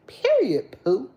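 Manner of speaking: high sassy "Period, poo" with a falling "Period"; cousin voice. Retold or imitated. Imitating her cousin's voice for live viewers, high and sassy. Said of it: "in my cousin voice".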